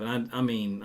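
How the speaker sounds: ordinary talk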